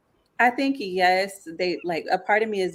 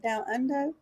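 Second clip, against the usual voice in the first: mock Australian; 6 semitones higher, 5 dB quieter than usual. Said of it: "How do they say it?".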